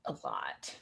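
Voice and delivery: breathy whisper tacked on at the end; a conspiratorial whisper; whispered aside. Real, not acted. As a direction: drop to a secret whisper for a joke. Playful whispered aside after "like.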